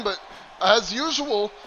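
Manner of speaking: ordinary delivery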